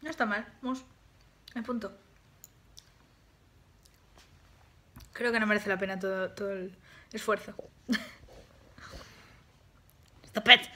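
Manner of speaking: talking after swallowing; holding dessert while talking; just ate a bite. Real, not acted. Seen 2: spoon bites just before; chews then talks, holding cup throughout.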